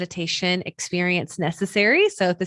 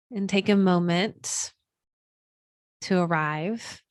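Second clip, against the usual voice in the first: unhurried, soothing breathwork guidance; guided meditation. Acted. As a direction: guide them gently into stillness, leaving room between phrases.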